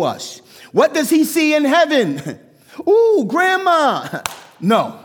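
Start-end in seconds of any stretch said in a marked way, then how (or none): 2.9-4.2 high, slow, delighted coo; excited greeting. Pretend. Imagined John greeting his grandma in heaven, delighted high coo. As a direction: gush like spotting family.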